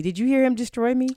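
ordinary talk, nothing marked

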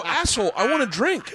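high-pitched comic indignation; exasperated protest, re-enacted; retold angry whine. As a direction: whine at a friend in mock outrage. Retold quarrel over cut-off drinks.

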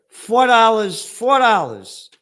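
loud, stressed complaint; outraged repeat. Real, not acted. Ranting host complains loudly about newspaper price. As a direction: hammer the price with disgust.

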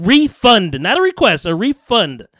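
"Refund" hit loud and high, then "a refund" falling; stress on refund. Real, not acted. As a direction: spell out "refund" as the word that matters. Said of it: "Words mean things".

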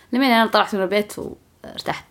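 normal talking voice

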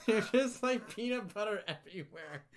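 loud, high, laughing disbelief; exasperated nurse. Retold or imitated. Nurses complaining about peanut butter, loud laughing exasperation. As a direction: blurt it out, half laughing.

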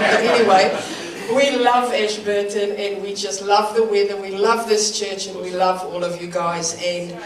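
ordinary talk, nothing marked

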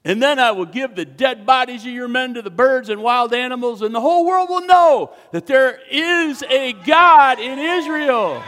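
loud, high defiant declaration; loud proclamation. Acted. David declaring God's victory to the giant, loud. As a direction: ring it out over the field.

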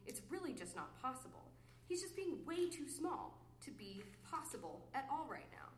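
normal talking voice

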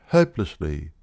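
ordinary delivery

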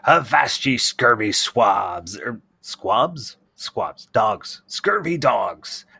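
put-on loud, slow old pirate voice; pirate talk. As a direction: growl it like a salty sea dog. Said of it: "stereotypical pirate talk".

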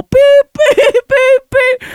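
high, loud mock alarm beep through a laugh; giggling imitation of an alarm. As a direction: beep like a machine while cracking up.

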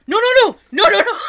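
high, loud imitation of a caught kid; mock panic. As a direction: blurt it out in hammed-up alarm. Kid caught gaming by dad.

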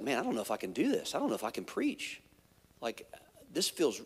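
quiet, fast, anxious self-talk; hushed panic. Retold or imitated. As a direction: mutter the doubt under your breath. Inner panic before preaching.

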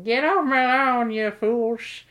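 put-on grumpy old geezer yelling at kids; old man voice. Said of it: "that's what I'd say".

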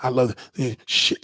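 ordinary talk, nothing marked

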